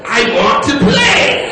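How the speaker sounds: high, slow demonic imitation; possessed girl. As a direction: drag it out like someone possessed. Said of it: "like the exorcist".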